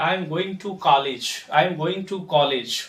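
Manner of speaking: "college" ending in "-lej" with an e; slow sentence, "college" as "caw-lej"; common mispronunciation. Shown as a demonstration. Teacher showing common usage, "college" as "caw-lej". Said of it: "जो बिल्कुल गलत है".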